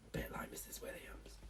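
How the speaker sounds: hushed teasing aside; whisper. Real, not acted. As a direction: breathe it as a secret joke. Whispered joke to children.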